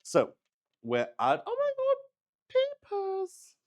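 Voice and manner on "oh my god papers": very high, drawn-out squeal of surprise; surprised squeal. Real, not acted. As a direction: squeak it out as the papers catch your eye. Suddenly noticing her papers.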